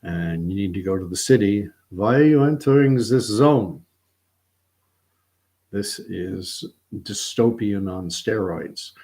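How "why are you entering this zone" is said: loud, higher-pitched challenge, mock official; checkpoint guard. Guard questions someone going to the city, mock officious challenge. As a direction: challenge a traveller like a zone guard.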